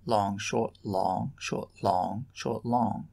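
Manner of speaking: long short syllables chanted with rising tune; scanning the pattern aloud; rhythm recited. Shown as a demonstration. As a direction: chant the metre, leaning on each long. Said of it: "The locution".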